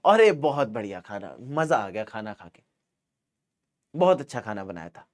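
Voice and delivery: sweet polite praise of a host's cooking; polite guest praise. Shown as a demonstration. Guest politely praises the hostess's cooking, soft and courteous.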